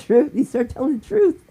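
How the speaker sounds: high, loud triumphant cry; excited outburst. Retold or imitated. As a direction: shout it out like you've been proven right. Vindicated by the dying friend.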